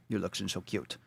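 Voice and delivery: put-on soft cooing baby talk. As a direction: coo at the pet softly. Cooing to pet.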